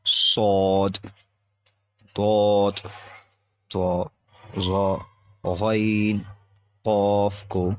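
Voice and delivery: full, thick-mouthed Arabic letters; reciting the heavy Arabic letters. Shown as a demonstration. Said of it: "اور انہیں موٹا پڑھتے ہیں".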